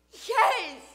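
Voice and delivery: loud, high-pitched yes; emphatic assurance. Acted. Boy assuring grieving father, emphatic. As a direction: insist with full conviction.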